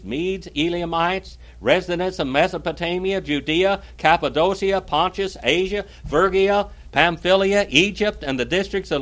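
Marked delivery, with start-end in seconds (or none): none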